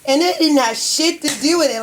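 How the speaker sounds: ordinary talk, nothing marked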